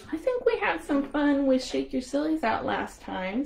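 ordinary delivery

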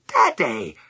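put-on a boy's glad shout; calling out to his mouse; cried out. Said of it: "cried Danny".

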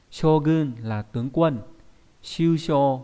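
slowed classroom readings of Shogun and shusho; unhurried teacher demo; slow careful reading. Shown as a demonstration. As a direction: model the Japanese word for students. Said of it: "đọc là".

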